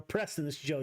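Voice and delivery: usual speaking voice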